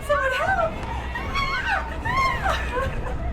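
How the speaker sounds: high mock-panicked shout for help, then shrieks; scream for help. Real, not acted. Streamer screaming along at a horror movie, panicked. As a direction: scream along with the victim on screen.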